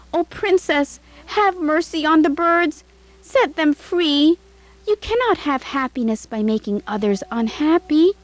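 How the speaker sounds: high, gentle begging; pleading maid. A narrator's reading. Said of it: "tried again".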